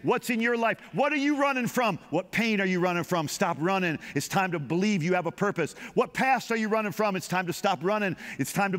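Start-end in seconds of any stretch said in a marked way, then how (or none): none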